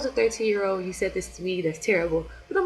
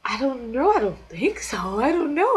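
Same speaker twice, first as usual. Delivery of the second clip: unsure, pitch jumping up and louder; flustered denial; 5 semitones higher, 5 dB louder than usual. Retold or imitated. Answering the photographer about auditioning, flustered. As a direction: fend off the question, voice rising.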